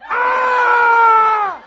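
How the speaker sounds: long, high, loud scream; exasperated scream. Retold or imitated. Traveler hears the Wi-Fi is down, screaming. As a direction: scream out one sustained cry of frustration. Reaction to being told no Wi-Fi.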